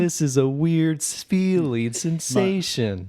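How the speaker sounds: put-on high, slowed spaced-out baby; tripping baby voice. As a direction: stretch sensation like you're high. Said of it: "He's like".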